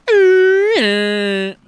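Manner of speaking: high, loud, wailing whale cry; whale call. Retold or imitated. Streamer imitating the Finding Nemo whale sound, high loud wail.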